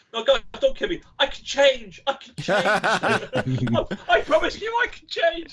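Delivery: high, desperate begging through laughter; mock pleading from a bully about to be thrown off a tower; pleading prince. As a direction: beg for your life in a squeaky hammed-up voice. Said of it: "And he was going".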